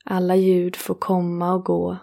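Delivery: ordinary talk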